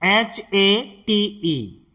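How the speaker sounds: letters spelled out slowly, one by one; slow letter-by-letter spelling. Shown as a demonstration. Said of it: "इसे हम क्या पढ़ेंगे".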